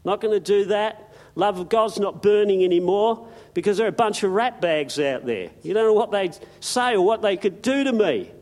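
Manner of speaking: high, loud preacher's exhortation; fiery preaching. Acted. Preacher rousing his church, loud and high. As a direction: preach it with fire to the congregation.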